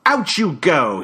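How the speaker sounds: put-on curt British accent. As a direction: throw them out in a British accent. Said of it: "in that British accent of his".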